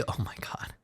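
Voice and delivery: breathless whispered excitement as the series lead grows; hushed disbelief at a winning streak; excited whisper. Real, not acted. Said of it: "I got excited".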